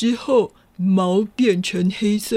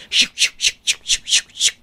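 whooshing sound effect; much faster than usual. Acted. Wolf transforms.

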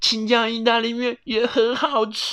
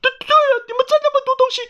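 very high, stuttering hen voice; high, loud, quick character voice; flustered, grateful mother hen; 5 dB louder, much faster than usual. Acted. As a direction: gush thanks like an excitable hen. Mother hen speaks in story.

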